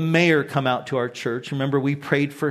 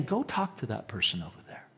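inner prompting; 8 semitones lower, 12 dB quieter, faster than usual. Retold or imitated. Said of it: "I'll just sense the Lord saying".

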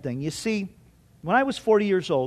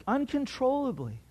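ordinary talk, nothing marked

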